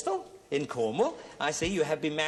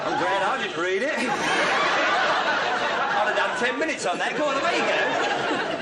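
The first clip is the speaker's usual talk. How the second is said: laughing; 3 semitones higher, 5 dB louder, slower than usual. Real, not acted. Host laughing at the card.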